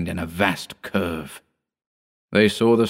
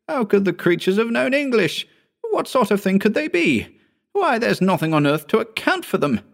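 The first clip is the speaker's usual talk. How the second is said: put-on agitated young assistant; 14 semitones higher than usual. A narrator's reading. Hugh's agitated questions.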